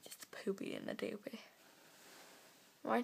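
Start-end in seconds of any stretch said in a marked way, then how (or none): 0.0-1.4 whispered, worn out and silly; drained little whisper; tired whisper. Real, not acted. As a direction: breathe it out like you're pooped. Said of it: "I'm just so pooped".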